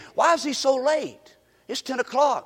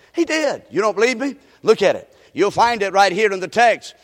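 usual speaking voice

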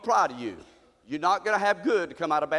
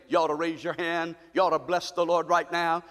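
ordinary talk

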